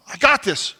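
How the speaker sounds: defensive husband brushing off wife; bristling defensiveness. Retold or imitated. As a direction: bristle at the advice. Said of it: "I bristle".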